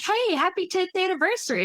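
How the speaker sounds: chirpy corporate voice. Retold or imitated. Company email voiced.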